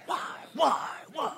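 high, far-off mock lament; a hammed-up cry to the heavens; mock wail. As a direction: wail it in pretend despair. Mock lament after joke.

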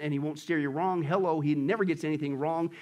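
normal talking voice

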